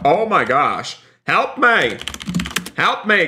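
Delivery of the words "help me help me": high-pitched cry for help; hammed-up victim calling out; play-acted distress. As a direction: plead for rescue in mock panic. Pushed down in the game.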